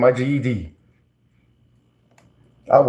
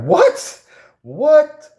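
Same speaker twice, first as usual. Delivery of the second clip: squeaky, much higher, louder double what; retold stunned what, pitched way up; shocked disbelief; 6 dB louder than usual. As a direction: replay your jaw-dropping surprise. Retelling shock at hearing news.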